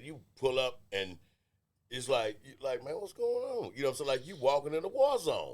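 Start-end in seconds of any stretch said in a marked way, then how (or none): 2.8-3.7 high, bewildered question; high-voiced comic bewilderment; mock alarm. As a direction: act thrown by what you walk into. Acted startled arrival at tense house.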